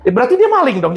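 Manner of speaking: usual speaking voice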